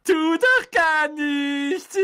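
high, slow, mocking goblin voice; cackling poltergeist. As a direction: cackle it out like a cheeky ghost. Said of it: "gackerte Peeves". (hoch, langsam, gackernd wie ein Poltergeist)

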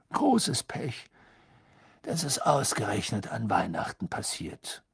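put-on soft, breathy old wizard; gentle old headmaster; Dumbledore voice. A narrator's reading. (sanfter alter Zauberer)

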